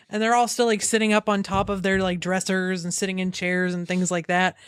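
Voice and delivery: ordinary delivery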